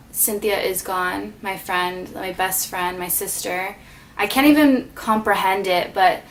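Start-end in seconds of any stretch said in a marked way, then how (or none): 0.1-3.8 soft, fading words as grief rises; getting emotional. Real, not acted. Remembering her late best friend, softer and breaking. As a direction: let it drop to almost nothing, holding back tears. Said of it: "why am I getting emotional".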